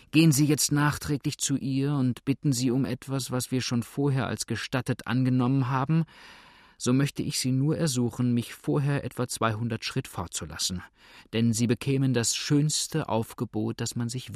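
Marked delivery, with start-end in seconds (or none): none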